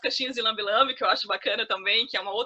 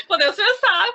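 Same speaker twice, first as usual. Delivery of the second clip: laughing it out, higher and louder; amused laugh on "Podemos pensar"; 8 semitones higher, 8 dB louder than usual. Real, not acted. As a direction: say it through a laugh. Laughing at own joke.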